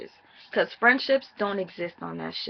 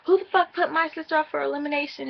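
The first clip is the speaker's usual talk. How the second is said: imitating a furious sister, fast and higher; mock outrage, rushed and pitched up; retold angry outburst; 7 semitones higher, 3 dB louder, faster than usual. Recapping Gabby raging over her sister's nomination, fast mock fury. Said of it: "wow, she's pissed off".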